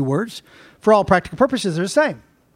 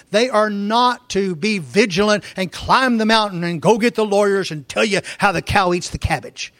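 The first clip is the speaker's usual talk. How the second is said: usual speaking voice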